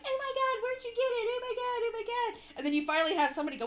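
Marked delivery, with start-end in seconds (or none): 0.0-2.4 high squealing gush; mock-gushing with pitch way up.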